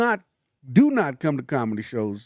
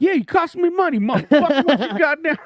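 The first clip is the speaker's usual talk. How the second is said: high, loud bettor's rant; mock outrage; 13 semitones higher, 5 dB louder than usual. Gambler defending his yelling at player, hammed-up anger. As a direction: explode high and loud about the lost money. Mock outburst over lost bet.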